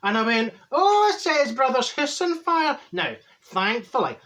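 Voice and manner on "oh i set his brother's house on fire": mock shock.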